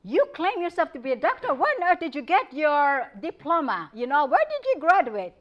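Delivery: retelling a patient's accusing questions, lightly acted; mock angry patient. Schizophrenic patient demanding the doctor's diploma, mock angry. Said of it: "schizophrenic patient who said to me".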